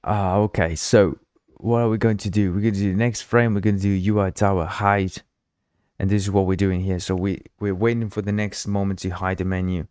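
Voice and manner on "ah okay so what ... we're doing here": ordinary delivery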